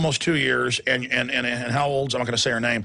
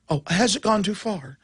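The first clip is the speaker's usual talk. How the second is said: higher, mock-innocent question; mock wondering; 6 semitones higher than usual. Host scoffing at the newspaper's question, mock wondering. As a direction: echo the question with feigned wonder.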